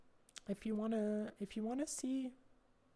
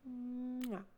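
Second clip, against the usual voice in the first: kiss sound; 3 semitones higher, 3 dB quieter than usual. Real, not acted. Blown kiss to viewer.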